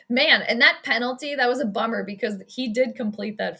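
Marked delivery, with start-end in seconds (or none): none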